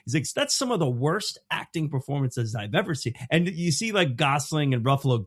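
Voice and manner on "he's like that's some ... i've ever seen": imitated indignant rant, pitch well up; ranting Robert Duvall. Veteran actor goes off on Kubrick, mock outraged. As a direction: go off on a director, high and emphatic. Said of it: "he just goes off on Kubrick".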